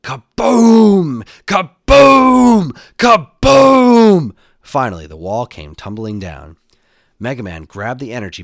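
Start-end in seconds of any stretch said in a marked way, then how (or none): 0.0-4.3 very high, very loud booming shouts; storyteller as exploding bombs; repeated explosions. A narrator's reading. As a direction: belt out each blast with a long falling vowel. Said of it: "threw three more bombs".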